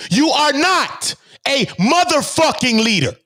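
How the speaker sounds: slow, high-pitched, heavy stress on NOT; emphatic telling-off. Real, not acted. Streamer repeating the rebuke so it sinks in, slow emphatic scolding. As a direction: spell out "are NOT a motherfucking leader" slowly and forcefully. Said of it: "Do I have to say it like that to you?".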